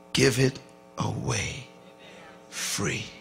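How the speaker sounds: slow, soft, each word laid down deliberately; slow soft emphasis. Shown as a demonstration. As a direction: make them lean in to hear it. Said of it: "listen carefully".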